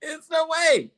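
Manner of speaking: very high, drawn-out disbelief; high mock-outraged fan denial; disbelieving squeal. Real, not acted. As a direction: squeal it like it can't be true. Laughing disbelief at trade.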